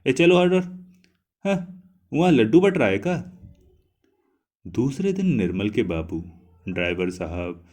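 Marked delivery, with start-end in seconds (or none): 0.1-3.3 put-on high, loud scolding of children. A narrator's reading. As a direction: shoo the kids away loudly. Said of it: "बुआ ने डांटा". (बच्चों को डांटती ऊँची आवाज़)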